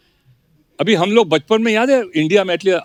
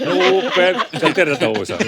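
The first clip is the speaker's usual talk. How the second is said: Pran impression; slower than usual. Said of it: "प्राण साहब को बचपन में ट्रिग्नोमेट्री".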